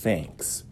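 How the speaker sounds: "Thanks" quiet, tongue between teeth; soft th. Shown as a demonstration. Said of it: "assim, ó".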